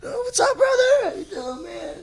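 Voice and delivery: very high, squeaky fake greeting; mock friendly cover-up. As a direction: bluff a falsetto hello. Acts out covering for forgotten name.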